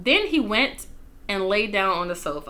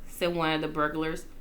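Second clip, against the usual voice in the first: plain reading voice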